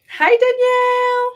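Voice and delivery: excited high-pitched hello to a viewer; high excited greeting. Real, not acted. Streamer squealing hello to a viewer in chat, excited.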